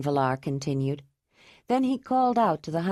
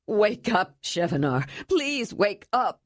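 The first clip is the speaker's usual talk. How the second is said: wailing plea; 3 semitones higher than usual. Acted. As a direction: beg the dying brother to wake. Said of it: "the young man wailed".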